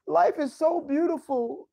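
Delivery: high, slow starry-eyed sigh of wonder; mock dreamy. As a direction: gush about life like a naive dreamer. Mock dreamy praise of life.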